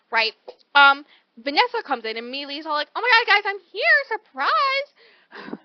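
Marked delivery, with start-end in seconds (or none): 3.0-5.6 higher and louder, hammed-up cheerful arrival; mock excited friend announcing herself; bubbly entrance. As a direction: burst in like an oblivious perky friend.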